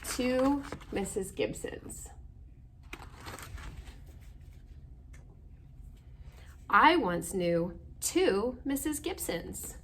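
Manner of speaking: slow, clear storybook reading; reading to children. Acted. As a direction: read the title and opening slowly to little listeners. Reading picture book aloud.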